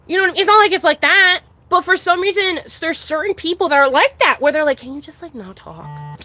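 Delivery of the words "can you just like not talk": quiet, dropping lower at the end; muted mock shushing request; hushed mimicked request. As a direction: imitate someone softly asking for silence. Quoting people who demand quiet.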